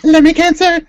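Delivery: shouted out loud and high; excited shout. Real, not acted. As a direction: blurt the name out with glee. Excited repeat of a funny name.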